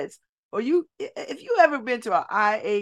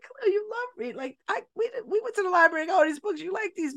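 much higher, cheering on a discouraged son; coaxing mom; 10 semitones higher, faster than usual. Retold or imitated. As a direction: lift him up, high and encouraging.

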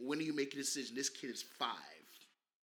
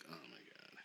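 very low, soft retold reaction; hushed dismay; 12 semitones lower, 17 dB quieter than usual. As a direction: say it under your breath, dropped low. Retelling own stunned reaction to costume.

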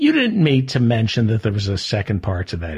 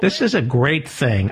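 ordinary talk, nothing marked

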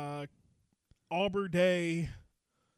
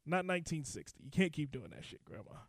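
ordinary talk

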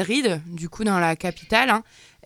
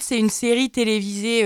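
ordinary delivery

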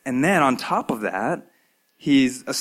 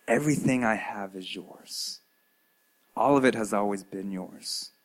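softer, fading to hushed tenderness; gentle father; 2 semitones lower, 4 dB quieter than usual. Acted. Father reassuring the elder son, gentle and fading soft. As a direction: reassure him, quieter and quieter. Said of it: "he doesn't rebuke him".

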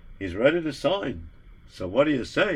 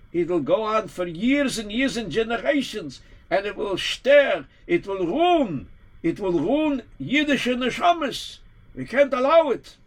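rebbe rising higher and more urgent, slowing on the warnings; pleading a fear that grows with each phrase; alarmed rebbe; 7 semitones higher than usual. Acted. Rebbe warns of the book, rising alarm.

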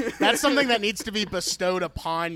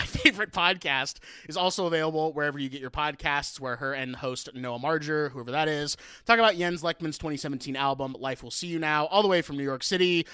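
usual speaking voice

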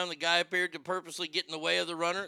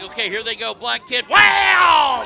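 loud, high race call; play-by-play; 4 semitones higher, 13 dB louder than usual. Pretend. Host calling the replay to listeners, excited shouted call. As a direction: yell the punch like a sportscaster.

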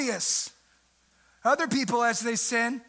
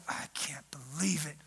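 a muttered whisper of disbelief; mock whisper; 9 semitones lower, 10 dB quieter than usual. Preacher voices religious people muttering about Levi, whispered. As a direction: hiss it under your breath, mocking.

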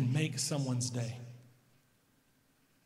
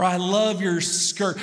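slow, louder gushing compliment; slow, louder adoring praise for his little girl; 6 dB louder, slower than usual. Retold or imitated. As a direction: lay the love on thick. Dad praising daughter.

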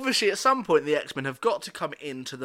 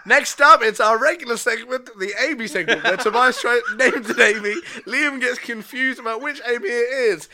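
announcer; 7 semitones higher, 7 dB louder than usual. Pretend. Mock show announcement.

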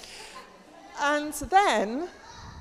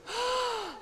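gasp; 9 semitones higher, 9 dB quieter than usual. Acted. Jonah swallowed by fish.

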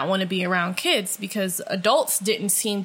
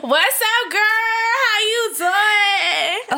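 high loud slow drawn out greeting; hammed-up high girlfriend greeting; casual code switch; 12 semitones higher, 4 dB louder, slower than usual. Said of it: "I was code switching like a mother".

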